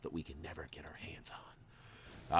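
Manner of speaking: soft breathy whispered aside. Real, not acted. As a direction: let it fade into a secret whisper. Teasing torture chamber aside.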